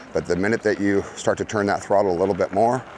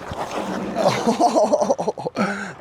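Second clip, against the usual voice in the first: startled laugh; 11 semitones higher than usual. Real, not acted. Laugh during the crash.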